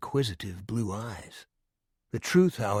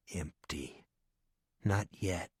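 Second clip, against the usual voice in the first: whisper; 3 semitones lower, 5 dB quieter, slower than usual. A narrator's reading. Eerie dread in empty room.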